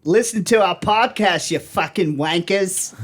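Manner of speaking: high, hammed-up Aussie call-out; cheerfully abuse listeners in broad fake Australian; mock Australian accent. Podcaster calling to listeners in Australia, mock Australian accent.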